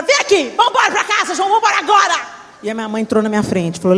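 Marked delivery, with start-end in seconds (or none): 0.0-2.3 angry. Retold or imitated. Grabbing daughter to leave.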